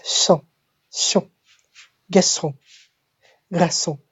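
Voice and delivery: "son" with an S sound and nasal vowel, then whole "garçon"; soft French C. Shown as a demonstration. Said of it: "very special C".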